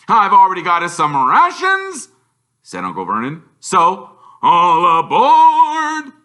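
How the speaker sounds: high, loud, slow gloating then a cackle. A narrator's reading. Uncle Vernon to family, gleeful and loud. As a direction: relish the storm news and laugh. Vernon hustling them aboard.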